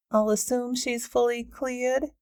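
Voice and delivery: statement that turns into a question on cleared?; pointed query rising on the last word; rising at the end. A narrator's reading. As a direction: lift the end into a question, slow. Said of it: "Delilah's voice went up at the end".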